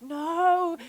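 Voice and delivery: loud, high "No" of alarm; alarmed cry. Retold or imitated. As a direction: cry out as he heads for the creek. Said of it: "I was like".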